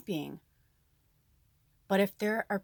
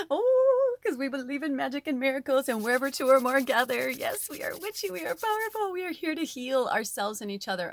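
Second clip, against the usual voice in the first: higher, sing-song and gushing about magic; witchy cheerleader, much higher; thrilled rallying cry; 6 semitones higher, 4 dB louder than usual. Real, not acted. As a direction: hype up the coven, starry-eyed. Rallying her viewers, thrilled.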